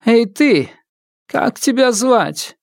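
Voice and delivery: high, slow haughty call; stern widow. Acted. Widow addresses crippled ghost.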